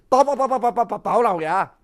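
fast stuttering begging, voice changed; stammering plea near tears. Acted. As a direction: stutter out the title, nearly weeping. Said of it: "规个人计要哭出来".

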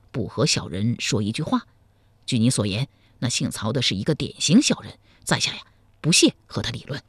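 calm, scornful of the scoundrel; hero's low voice. A narrator's reading. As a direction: hero explaining calmly to the girl. Voiced Zhao Kuangyin.

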